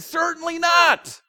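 high, loud, slow shout; outraged denial. Acted. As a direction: shout it like an offended crowd. Crowd rejects Jesus' warning.

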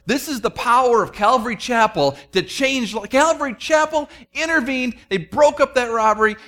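high, loud proud gloat; mock church boasting. Preacher mocking his carnal pride, boastful. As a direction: crow about his own church, hamming it up. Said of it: "'cause I'm kinda carnal".

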